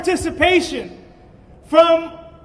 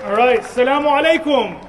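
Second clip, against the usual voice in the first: ordinary talk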